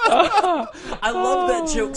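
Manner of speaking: laughing while speaking. Real, not acted. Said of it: "laughing after the joke".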